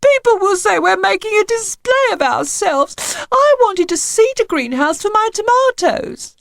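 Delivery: high, tearful complaining wife; weepy and high, full of grievance. A narrator's reading. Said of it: "wept Missus Spender".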